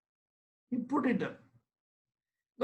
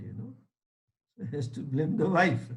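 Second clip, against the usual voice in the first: ordinary delivery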